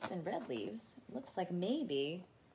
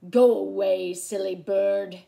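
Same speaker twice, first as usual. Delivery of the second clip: loud character call; 12 dB louder than usual. A narrator's reading. Theodore shooing a bird from his cave, loud and commanding. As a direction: send the bird off at full volume. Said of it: "he whispered".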